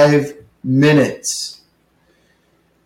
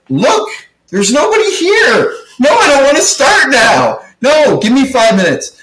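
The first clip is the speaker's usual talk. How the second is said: much higher, pleading, worked-up retelling; exasperated plea; 10 semitones higher than usual. Retold or imitated. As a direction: beg him like you're at your wits' end.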